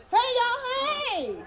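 high, loud sing-out greeting; whooping, over-friendly hello; quaint Southern greeting. Shown as a demonstration. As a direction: holler a folksy hello. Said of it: "That's my new greeting".

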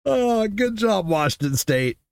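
normal talking voice

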